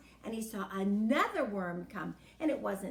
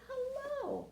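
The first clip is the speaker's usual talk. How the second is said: lightly voiced old man, higher; a hint of a frail old man saying hello; mild old man greeting; 12 semitones higher than usual. A narrator's reading. Old man answers Herman.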